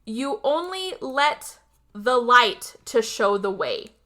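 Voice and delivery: slow, pointed recital; deliberate quotation. Retold or imitated. Host quoting the villain's baffling line, slow and deliberate. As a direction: quote it slowly, phrase by phrase. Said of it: "and I quote".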